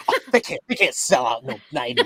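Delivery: imitating his wife's dismissive scoff. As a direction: scoff in mock disbelief, a laugh creeping in. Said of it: "she kinda scoffed at it".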